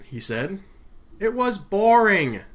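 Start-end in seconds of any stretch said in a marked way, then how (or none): 1.2-2.4 higher, louder, drawn-out complaint; matter-of-fact child. A narrator's reading. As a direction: draw it out like an unimpressed kid. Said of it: "she said matter-of-factly".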